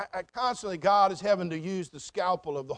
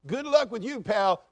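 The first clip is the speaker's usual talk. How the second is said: scoffing skeptic; 4 semitones higher, 7 dB louder than usual. Acted. Mocking a skeptic of kindness.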